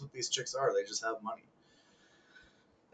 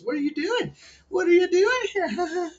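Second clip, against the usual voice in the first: very high squealing falsetto; 4 dB louder than usual. Retold or imitated. Two chicks coming on to him at the club, mock falsetto. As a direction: imitate girls cooing in exaggerated falsetto. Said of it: "I had two chicks come up to me".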